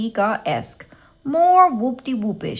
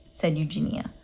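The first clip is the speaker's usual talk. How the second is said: usual speaking voice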